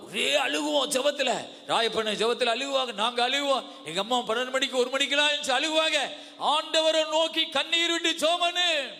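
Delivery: high, impassioned sermon voice; full-force preaching. Real, not acted. (உரத்த ஆவேசப் பிரசங்கம்)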